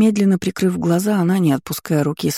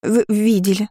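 stuttered start, pitched up; shy maid answering, stammering; 4 semitones higher than usual. A narrator's reading. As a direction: trip over the first sound nervously. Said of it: "с заиканием продолжила Лия".

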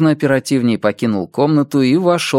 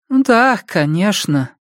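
reluctant; 7 semitones higher, slower than usual. Acted. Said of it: "неохотно произнести".

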